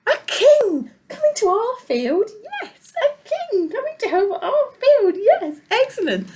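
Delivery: excited sheep. Acted. Sheep hearing a king is coming, high and excited. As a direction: squeal with delight at the news.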